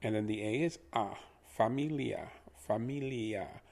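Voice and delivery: ordinary talk, nothing marked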